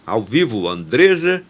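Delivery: upbeat announcer read introducing the guest; podcast host intro. Acted. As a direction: present the show and welcome the guest on air.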